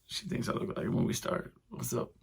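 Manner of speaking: delighted, secretly thrilled; a giddy squeal under the breath. Retold or imitated. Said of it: "I left all giddy, like".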